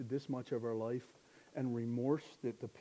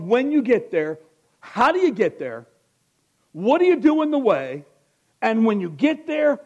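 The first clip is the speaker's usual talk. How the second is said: loud, raised-pitch preaching; full-force preaching; 10 semitones higher, 17 dB louder than usual. Acted. Preacher promising the church very practical teaching, full-force preaching. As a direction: drive the promise home to the whole church.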